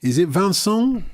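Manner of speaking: "Vincent" said the French way, van-SAHN, nasal last syllable. Shown as a demonstration. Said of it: "if he's French".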